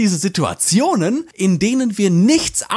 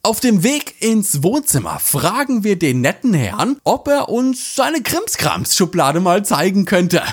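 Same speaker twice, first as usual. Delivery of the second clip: normal talking voice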